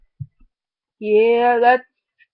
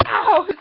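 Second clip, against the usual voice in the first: sharp loud yelp of pain; ouch; 4 semitones higher, 4 dB louder than usual. Real, not acted. Kid hurting himself on camera, sharp loud yelp. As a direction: yelp it high and sudden.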